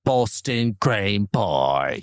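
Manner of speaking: very slow, drawn-out Boston cream pie; a light, slowed Boston voice; mock Boston accent. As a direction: stretch every word in a fake Boston accent. Joking Boston accent riff.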